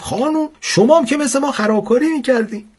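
put-on high-pitched child's teasing voice; cheeky schoolboy. Pupil catching out his teacher over her own mischief, cheeky. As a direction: pitch it way up like a delighted kid.

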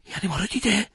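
high, tense question; uneasy high-pitched query; anxious wondering. A narrator's reading.

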